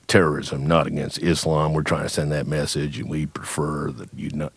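lightly voiced low, formal military officer; a touch of a stiff general politely refusing; stiff army general. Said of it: "a general from ... who said".

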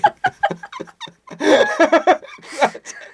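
real laugh rising high then falling; high burst of laughter. Real, not acted. Telling a Hollywood story, breaks into high laughter. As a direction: laugh hard at the punchline.

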